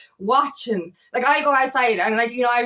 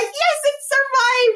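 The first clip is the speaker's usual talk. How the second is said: much higher, slow delighted cry; joyful relief; 4 dB louder, slower than usual. Pretend. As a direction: celebrate that the plant made it. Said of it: "you're like".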